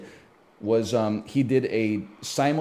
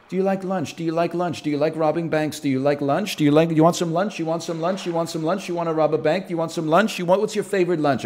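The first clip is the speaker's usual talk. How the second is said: rapid, repetitive mock-hypnotist patter; Darren Brown; 2 semitones higher, faster than usual. Mentalist priming someone to rob a bank, rapid mock patter. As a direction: fire off the questions like a quick, insistent mentalist. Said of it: "Darren Brown's like".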